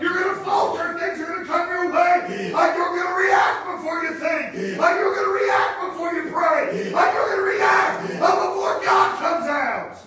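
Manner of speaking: shouted high sermon climax; preaching at full force. Acted. Preacher warning the congregation, shouting at full force.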